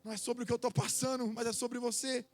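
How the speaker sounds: loud fervent preaching. Acted. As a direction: preach it at full force. Preaching at full force.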